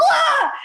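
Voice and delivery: a sudden high, loud shriek of panic; startled scream. Retold or imitated. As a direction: shriek as if caught red-handed. Caught by the mom.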